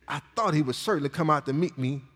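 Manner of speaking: soft reread. Shown as a demonstration. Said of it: "This, this is amazing".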